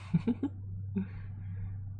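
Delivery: airy high giggle; a quiet breathy giggle; whispery giggle; soft giggle. Real, not acted. Giggle before signing off.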